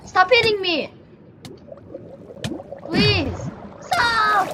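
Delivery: high, hammed-up cries of pain; mock pained pleas. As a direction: whine as if being beaten, playfully. Pretend beaten, begging.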